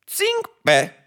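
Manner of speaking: very high-pitched courtly yelp; obedient chamberlain. A narrator's reading. Chamberlain answering the emperor's order, high obedient squeak. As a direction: squeak out an eager acknowledgement.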